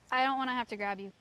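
normal talking voice

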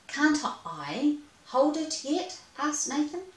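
high, slow pleading little mouse; high eager mouse. Acted. Little mouse begging to hold the fishing rod, high and pleading. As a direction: beg like a small eager mouse.

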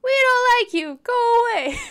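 very loud, high shout; zebra shouting. A narrator's reading. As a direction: yell it to drive him away. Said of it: "The zebra shouted".

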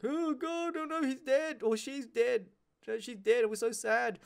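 high, hammed-up fake mourning; mock grief. Workplace pretending to mourn a dead worker, hammed-up high wail.

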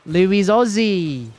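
slow, drawn-out mock Australian accent; a hammed-up Aussie twang; bad Aussie accent. Streamer greets viewer in a bad mock Australian accent. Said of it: "Your fucking Aussie accent, it's so bad".

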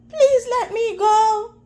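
high, tearful plea for mercy; pleading cry. Acted. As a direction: cry out, begging to be let go. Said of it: "Mouse cried".

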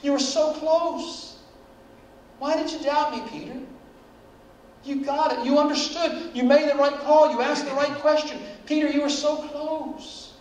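Jesus coaxing Peter like a fond parent; gentle parental Jesus. Pretend. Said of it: "he said".

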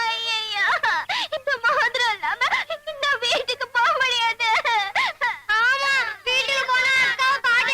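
wailing lament with sobs; crying. Acted. Afraid to go home without the ring, wailing. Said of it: "அழுகாதீங்க".

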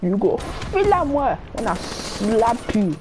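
a hint of a Nigerian accent, arguing back; lightly played Nigerian gamer trading insults; put-on Nigerian accent. Troll trading insults with teammates, mock Nigerian accent. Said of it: "I'm from Nigeria".

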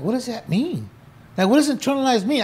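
genuinely lost, asking why; confused question. Retold or imitated. Confused inmate asking friends what internalize means. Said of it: "And I'm like".

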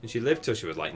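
usual speaking voice

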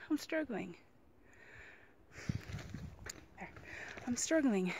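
winded mid-climb, breathy and tired; out of breath. Real, not acted. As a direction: gasp it out after climbing. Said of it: "to catch my breath".

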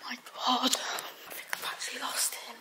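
anxious whisper. Real, not acted. Girl sneaking at night, anxious whisper. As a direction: breathe the words out scared.

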